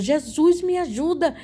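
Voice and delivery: high, reenacted plea to Jesus; crying out for help. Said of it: "comecei a clamar por Jesus". (clamor agudo de socorro, encenado)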